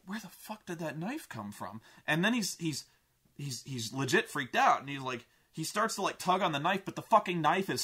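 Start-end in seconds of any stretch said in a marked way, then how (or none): 0.1-1.8 softer, stunned under the breath; quietly baffled; hushed disbelief. Retold or imitated.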